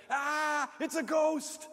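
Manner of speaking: high, loud terrified shout; frightened scream. Retold or imitated. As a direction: cry out in fear of a ghost. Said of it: "they started screaming and said".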